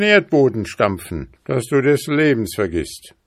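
loud, high-pitched hunter turning threatening; stern old hunter. Acted. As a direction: grant pardon, then warn forcefully. Old hunter pardons, then threatens.

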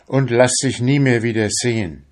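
plain reading voice

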